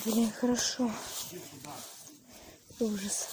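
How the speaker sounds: hiding whisper. Real, not acted. Hiding from the monster.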